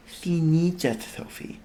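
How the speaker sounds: slow, soft and sincere; earnest. Acted. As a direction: plead it earnestly. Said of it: "Twinkle said earnestly".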